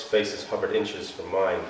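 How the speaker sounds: ordinary delivery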